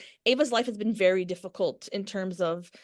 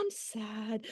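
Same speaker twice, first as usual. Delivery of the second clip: sudden mock sad; 12 dB quieter than usual. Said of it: "she'll be like".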